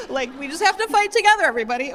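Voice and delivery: choked-up plea to fight together; getting teary. Real, not acted. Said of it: "I'm sorry, I'm getting teary".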